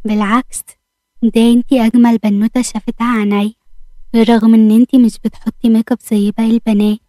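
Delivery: usual speaking voice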